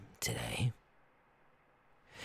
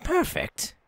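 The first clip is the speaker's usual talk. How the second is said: high-pitched. Acted. Captain pleased by news.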